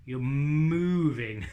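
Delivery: drawn out loud and very slow; slow drawn out demo. Shown as a demonstration. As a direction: stretch "moving" out slowly. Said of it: "moving the tongue in a more gentle way".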